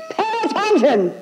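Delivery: high shrieking yell of Pay attention; hammed-up screeching command; fishwife scream. Shown as a demonstration. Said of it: "Screaming like a fishwife".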